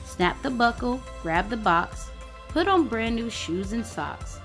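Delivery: ordinary delivery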